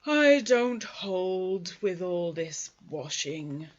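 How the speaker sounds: slow, loud, gloomy donkey grumble; grumbling Eeyore. Acted. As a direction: drag every word out like a disgruntled old donkey. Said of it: "grumbled Eeyore".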